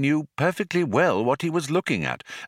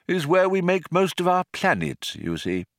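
put-on higher-pitched elderly showman, falling away at the end; lightly voiced proud old craftsman; old planet-builder, genial; 8 semitones higher than usual. A narrator's reading. Old man showing visitor where planets are made, genial.

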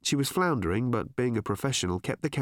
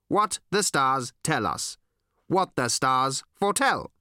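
high, deliberate alien voice; solemn alien, each phrase set apart; stilted, emphatic alien statements; earnest alien visitor; 4 semitones higher, 3 dB louder than usual. Acted.

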